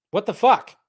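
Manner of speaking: normal talking voice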